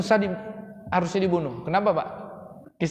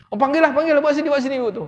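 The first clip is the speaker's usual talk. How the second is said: eager call; 8 semitones higher, 4 dB louder, faster than usual. Acted. Pharaoh's wife eager for nurse.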